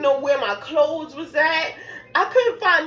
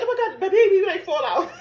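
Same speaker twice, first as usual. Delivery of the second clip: panicking; 7 semitones higher than usual. Retold or imitated. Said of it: "I'm panicking".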